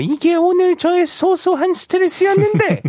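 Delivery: animated, fast, high-pitched read-out; listener message read. Acted. Radio host reading a listener's chat message, animated high read-out. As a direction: perform the message brightly and quickly, pitch swooping up.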